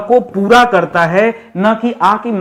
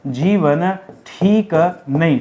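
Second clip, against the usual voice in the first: slow dictation; 3 semitones lower, slower than usual. Shown as a demonstration. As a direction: read it out word by word for notes. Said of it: "क्या लिखिए?". (धीरे-धीरे बोलकर लिखवाना)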